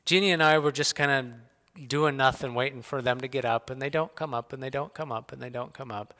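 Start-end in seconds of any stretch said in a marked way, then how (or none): 3.7-6.1 each repeat softer than the one before; fading repetition. Real, not acted. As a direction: let it trail off into silence. Waiting, repeating, fading away.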